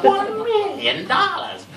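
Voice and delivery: high, loud, drawn-out mocking quote; mock a movie supervillain, stretching the words out loud; high-pitched, slow send-up of a film villain; Austin Powers villain impression. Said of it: "after them Austin Powers movies".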